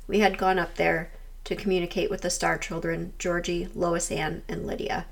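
calm documentary voiceover; voiceover narration. A narrator's reading. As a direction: narrate it like a ghost-hunt recap.

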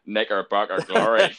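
practised Northern Irish catchphrase; Northern Irish accent. Shown as a demonstration. Showing how he rehearsed while driving, Northern Irish accent. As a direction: roll it out in put-on Northern Irish. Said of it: "just saying, like".

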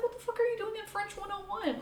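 high fast incredulous quote; baffled by advanced classmates; retold disbelief.

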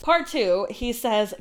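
normal talking voice

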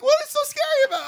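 high, fast, puzzled question; baffled squeak. Retold or imitated. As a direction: rattle it off in mock confusion. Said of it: "I'm like".

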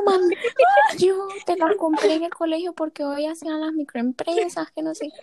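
put-on a little girl explaining to her mom; young self explaining.

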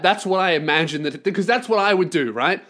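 ordinary talk, nothing marked

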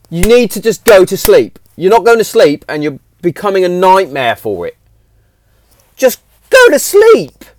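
high, loud fed-up telling-off; exasperated scolding. Retold or imitated. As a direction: scold her, at the end of your rope. Dad scolding daughter at bedtime.

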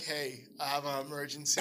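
low, soft deadpan delivery; no emotion. Retold or imitated. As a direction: deliver it like a terrible actor with zero urgency. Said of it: "like, no emotion at all".

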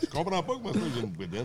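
talking through laughter; high laugh-filled joke. Real, not acted. Laughing about his belly.

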